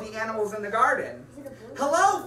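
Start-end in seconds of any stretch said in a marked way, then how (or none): 1.8-2.3 loud hello. Acted. Greeting the animals loudly.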